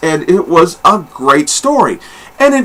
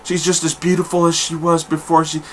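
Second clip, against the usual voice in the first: dreamy admiration; 8 dB quieter than usual. Retold or imitated. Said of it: "he's like, he's like".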